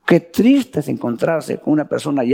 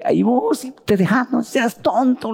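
higher, insistent scold; scolding pastor; 9 semitones higher than usual. Retold or imitated. Pastor telling the new convert not to be a fool, high and insistent. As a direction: tell him off, don't be a fool.

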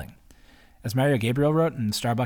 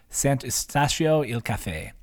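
slow, careful Italian accent; slower than usual. Shown as a demonstration. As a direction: sound out the café name in an Italian accent. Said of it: "My Italian accent's pretty bad".

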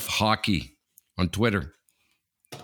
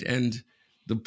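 ordinary talk, nothing marked

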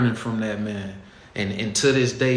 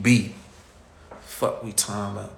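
usual speaking voice

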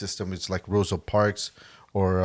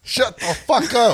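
laughing shout; 12 semitones higher, 5 dB louder than usual. Real, not acted. Laughing at friends' interruption.

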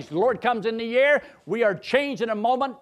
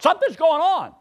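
retold alarm; 10 semitones higher, 6 dB louder than usual. Sister sinking into hell.

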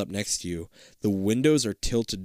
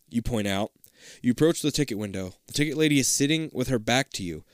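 ordinary talk, nothing marked

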